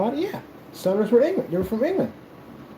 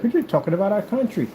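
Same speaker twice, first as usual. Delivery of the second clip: mocked patriot objector; 7 semitones higher than usual. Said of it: "You're like".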